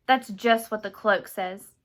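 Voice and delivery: loud, slow, forceful retort; panicked shout. A narrator's reading. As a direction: shout it back in agitation. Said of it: "Brayden shouted at her".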